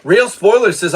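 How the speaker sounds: ordinary talk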